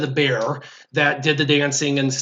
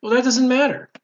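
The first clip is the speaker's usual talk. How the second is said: higher-pitched motherly brush-off; dismissive mom; 7 semitones higher than usual. Retold or imitated. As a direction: imitate mom waving away the problem. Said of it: "looks at me square in the face and she goes".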